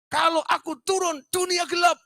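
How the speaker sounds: very high shouted proclamation; patient's answer. Retold or imitated. The patient tells the doctor why he stays up, high shout. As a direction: declare it like a madman. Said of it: "Bilang gitu loh".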